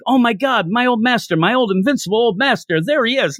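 high, thrilled cry of recognition; overjoyed to see the old master; excited reunion. Retold or imitated. Gu Mao spotting his old master, high and thrilled. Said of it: "Gu Mao is like".

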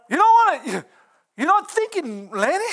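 high, loud mock wife scolding him; imitating his wife. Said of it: "she can tell me".

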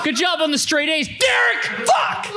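high furious shout through laughter; angry dad yell. Retold or imitated. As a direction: praise him but scream it. Mock dad yelling praise angrily.

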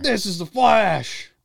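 high, loud and slow, like a movie villain; booming trailer voice. Pretend. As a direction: announce it with huge drama. Mock trailer voice, Flash bit.

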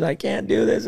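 a client near tears, voice climbing high and pleading; high tearful plea. Retold or imitated. Client who can't go on, imitated high and tearful. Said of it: "and they're in tears".

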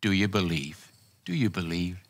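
soft, low, tender question; gentle and tender. Retold or imitated. The Lord asking a sick believer, gently and tenderly. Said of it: "he just very gently and tenderly said".

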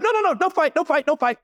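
high-pitched panicked surrender; panicked pleading. Retold or imitated. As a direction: squeal it out like he's in agony and giving up. Tom begging to stop fight.

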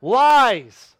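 fervent preacher denouncing lies; emphatic denial. Real, not acted. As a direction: spit out the word lies. Preacher rebuts false claim.

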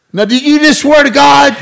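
very high, loud incredulous; mock amazed cop. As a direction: act stunned again. Cop asks about the oath.

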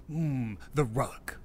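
usual speaking voice

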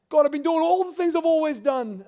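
high, loud protest to God; strained plea. Retold or imitated. Pastor telling God all he does, high loud protest. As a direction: push the complaint up and out.

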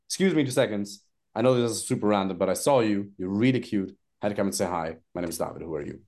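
low and easy pickup line; calm demo opener. Shown as a demonstration. Coach demos the daytime opener again, calm. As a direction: say it relaxed and low. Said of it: "It's like".

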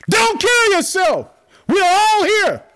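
high, loud, drawn-out shout; urgent yell. Acted. Paul stopping the jailer's suicide, yelling out. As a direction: yell slowly across a prison, high-pitched. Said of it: "Paul yells out".

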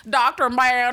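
loud, hammed-up Southern drawl; mock a bad Southern accent; cartoonish Southern accent. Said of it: "with his cartoonish Southern accent".

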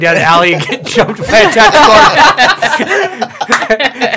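laughing loud and high while telling it. Real, not acted. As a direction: giggle through the whole story. Laughing through absurd scenario.

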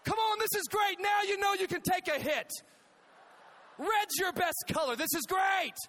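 high, loud gleeful yell; thrilled dad. Retold or imitated. Dad over his son's shoulder, thrilled shouting. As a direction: yell it like a delighted dad in the stands. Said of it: "I hear my dad over my shoulder going".

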